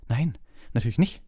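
a sharp, dismissive no; curt reply. A narrator's reading. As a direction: cut him off curtly. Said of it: "erwiderte Hermine schroff".

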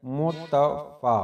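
मुतफ़ा slowly split as मु-त-फ़ा; syllable by syllable. Shown as a demonstration. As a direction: sound out मु, त, long फ़ा. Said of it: "जब एक, एक, दो है". (मुतफ़ा को तोड़कर धीरे-धीरे)

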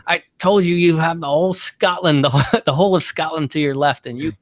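high, loud mock rant of a fed-up Scottish caddy; hammed-up angry caddy voice, breaking into laughs; exasperated caddy. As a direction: scold him like a caddy who's seen it all, half laughing. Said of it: "the caddy said".

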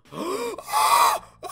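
sudden loud startled gasp; sharp gasp. Real, not acted. Gamer gasps on spotting an enemy, startled. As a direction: gasp loudly in shock.

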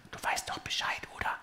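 heimliches, ganz leises Flüstern; flüster es verschwörerisch zu; geflüstert. A narrator's reading. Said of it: "Die letzten Worte flüstert er mir zu". (leise zuflüstern)

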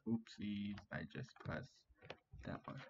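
whispered aside. Real, not acted. Muttered aside at computer.

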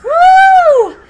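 high, loud triumphant whoop; real victory whoop. Real, not acted. Streamer cheering a narrow win, loud whoop. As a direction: let out a winning cheer. Winning a close round.

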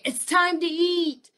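high, loud, drawn-out dinner call; yelling up the stairs. Retold or imitated. Mother calls children to dinner up the back stairs, yelling. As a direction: call out so the whole house hears. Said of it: "I would yell up".

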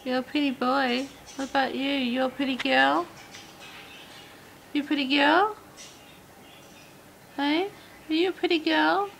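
high, sweet praise for a bird; pet talk voice. Real, not acted. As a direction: coo at a budgie. Cooing at budgies.

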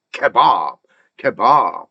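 "kebab" with a long, strong BAB; stress on second syllable. Shown as a demonstration. Teacher models the word, "kebab" stressed on the end. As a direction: lean on the a of "kebab". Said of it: "아에 들어가니까 kebab".

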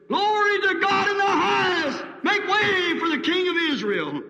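high, loud ringing praise shout; joyful shout. Retold or imitated. Crowd shouting praise as Jesus enters the city. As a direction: shout it out to the whole street.

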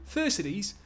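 big strong Geordie, calling out high; Geordie accent. Acted. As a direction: call sharply in a Geordie accent. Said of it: "give him a Geordie accent".

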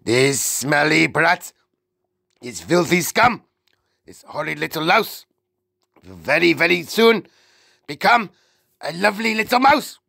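high, punched-out gloating phrases; witch shouting out. A narrator's reading. Witch clapping over the cursed boy, shouting out. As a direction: build in pitch to a triumphant finish. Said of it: "then she shouted out".

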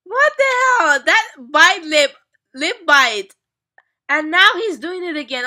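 loud, high, flustered exclamations; flustered outburst. Real, not acted. Fan reacting loudly to her bias's lip bite, flustered. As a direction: blurt it out, overwhelmed by him.

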